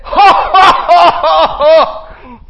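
loud, high booming ho-ho laugh; giant's mocking laugh. Goliath laughing at little David, loud and booming. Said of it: "and laugh at David".

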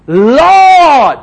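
high loud anguished call; crying out. Acted. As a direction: call out while sinking. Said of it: "he cried out".

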